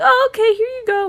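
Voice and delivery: high, loud fake-cheery; high faux-innocent payment; mock innocent. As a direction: act breezily innocent. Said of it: "We're like".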